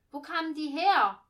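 high, loud demand to know; brash kid's question. A narrator's reading. Leopold interrupts rehearsal wanting to know about shepherds, brash and loud. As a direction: blurt it out like a pushy child.

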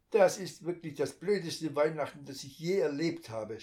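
loud, cross complaint after the fall; grumbling angrily. A narrator's reading. Muddy boy curses after falling in the mud. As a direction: rant about the worst Christmas. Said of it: "schimpft Lukas".

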